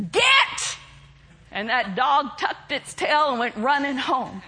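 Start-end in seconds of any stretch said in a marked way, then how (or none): 0.1-0.8 high, snapped order; sharp command to dog. Retold or imitated. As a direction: snap it with a stomp. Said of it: "she stomped her foot and said".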